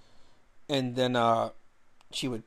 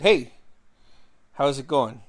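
retold greeting; 6 dB louder than usual. Answering a stranger on dating app, loud greeting. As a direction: call out a hello, louder.

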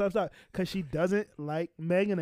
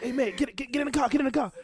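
higher-pitched, mock-panicked hurrying; retold urgent panic; 6 semitones higher, faster than usual. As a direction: hustle someone away as if acting out a getaway. Acting out panicked getaway after shooting.

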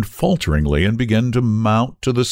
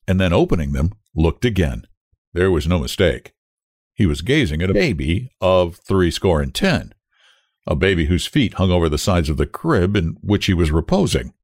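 usual speaking voice

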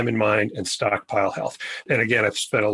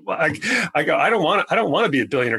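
laughing; 6 semitones higher, faster than usual. Real, not acted. Laughing over retold reply to billionaire.

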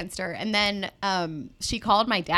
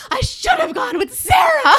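put-on a much higher, louder slow teenage tantrum yell; mock tantrum shout; 13 dB louder than usual. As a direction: wail it like a sulking teen at her parents. Retold teen tantrum.

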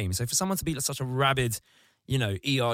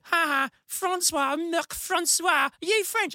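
much higher, slow sing-song taunt; taunting schoolkids; slower than usual. Retold or imitated. Schoolkids mocking Mark Francois's French name. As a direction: tease him like a playground kid. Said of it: "everyone was like".

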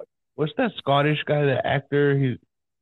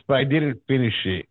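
normal talking voice

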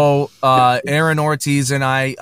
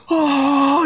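sharp intake, then high drawn-out "oh"; imitated gasp. Host imitates Aaron spotting them, high surprised gasp. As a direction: act out someone's startled reaction, pitch leaping way up. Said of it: "and then he just goes".

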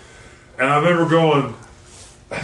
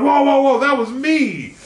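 whoa reaction; 8 semitones higher than usual. Retold or imitated. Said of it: "And I'm like".

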